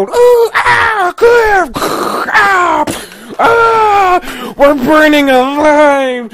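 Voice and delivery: very high, shrieking panic; panicked screaming. Pretend. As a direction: scream like the car is on fire. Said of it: "there's a flat tire and go".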